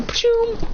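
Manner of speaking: high-pitched explosion whoosh; voiced action sound effect; imitated whoosh. Imitates flying back from explosion.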